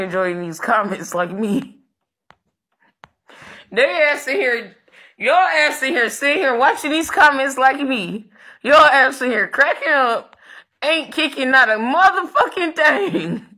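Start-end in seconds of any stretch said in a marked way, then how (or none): 3.7-13.5 high, loud scolding with a laugh; calling out admins. Real, not acted. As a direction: roast the lazy admins loudly.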